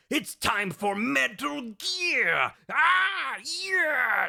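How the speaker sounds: high, drawn-out rallying yell; hyped announcement. Acted. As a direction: whoop it up like a big show opener. Hyped shouted title intro.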